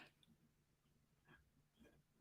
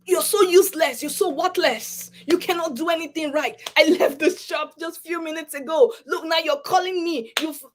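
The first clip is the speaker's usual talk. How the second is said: higher, scolding husband voice; angry husband; 8 semitones higher than usual. Retold or imitated. Husband berating his wife in the shop, angrily. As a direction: belittle her, pitched up in anger. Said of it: "And then he came and said".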